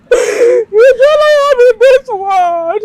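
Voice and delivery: weeping. Acted. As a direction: let the grief break out. Mother weeping for son.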